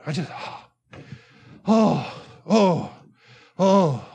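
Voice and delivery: repeated heavy exhaled "oh"; acted heavy sighing. Preacher imitating David sighing all the time, heavy sighs. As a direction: sigh out each "oh", weighed down. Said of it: "He keeps sighing and exhaling".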